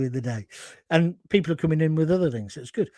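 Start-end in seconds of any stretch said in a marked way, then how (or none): none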